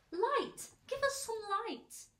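high, loud clamour for help; crowd crying out. A narrator's reading. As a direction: call out loudly, pitched high. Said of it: "they cried".

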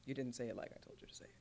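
fast whispered scolding; mock director whisper. Director whispering a fast scold at the actor. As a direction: hiss the rebuke like a fussy director.

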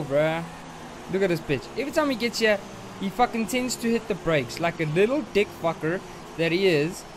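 usual speaking voice

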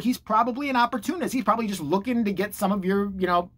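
normal talking voice

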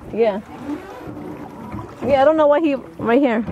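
ordinary talk, nothing marked

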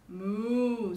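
loud storybook moo; cow's moo. A narrator's reading. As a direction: moo out like a cow. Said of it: "said the cow".

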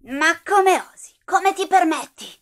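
loud, high indignant outburst; outraged shout. Acted. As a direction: scream your outrage at the insult. Indignata dall'insulto. (urlo indignato)

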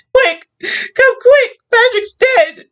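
high, loud panicked cry; frantic cry. Acted. Wife calling police about dead husband, frantic loud cry. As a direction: scream for help down the phone. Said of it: "she cried to him".

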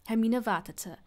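usual speaking voice

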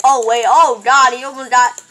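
loud, startled exclamation; alarmed outburst. Real, not acted. Player reacting to a near miss, loud alarm. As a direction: blurt it out loudly in alarm.

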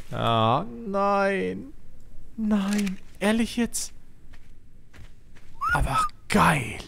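can't believe his eyes; stunned disbelief. Real, not acted. Streamer reacting to an amazing find, high disbelief. Shocked by what he finds.

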